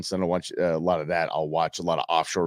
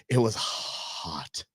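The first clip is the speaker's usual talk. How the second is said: drawn out slowly and softly, wilting in the heat; slow wilting drawl; 5 dB quieter, much slower than usual. Acted. Host to listeners about the weekend heat, slow, soft and wilting. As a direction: sag through it like the heat is crushing you. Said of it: "Oh, yeah, yeah".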